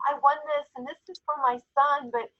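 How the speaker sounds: a hint of the customer, lifted pitch; quoting the customer. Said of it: "She goes".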